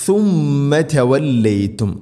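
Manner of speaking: high, slow tajweed chant; Quranic recitation. Shown as a demonstration. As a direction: chant it with a long hummed m. Quran teacher models the recitation.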